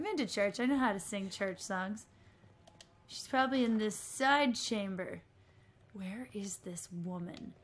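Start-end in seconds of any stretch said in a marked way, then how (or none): 5.9-7.5 whisper. Real, not acted. Whispered frustration.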